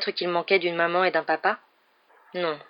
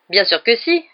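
loud, higher indignant retort; crying out; 5 semitones higher, 6 dB louder than usual. A narrator's reading. Aunt insists she does believe in evolution, crying out. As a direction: cry it out in protest. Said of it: "s'écria tante Pétunia".

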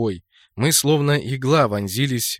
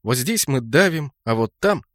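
plain reading voice

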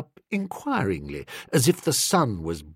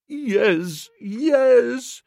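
high, drawn-out plaintive moan; 10 semitones higher than usual. A narrator's reading. Wronged man agreeing with his defender, moaning. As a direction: moan it pitifully in a put-on voice. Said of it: "moaned the poor man".

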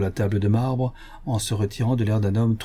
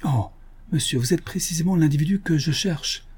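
higher-pitched eager cry; excited exclamation; 7 semitones higher than usual. A narrator's reading. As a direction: exclaim as the agitated little man. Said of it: "s'écria le petit homme".

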